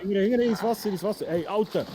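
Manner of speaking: loud, high alarm calls; urgent shouting. Real, not acted. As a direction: shout the warning in panic. Fleeing the horde.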